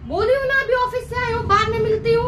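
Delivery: angry scolding. Acted. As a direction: tell the child off, fed up after work. Tired mother scolds her child. (थकी माँ बच्ची को डाँटती, ऊँची गुस्से वाली आवाज़)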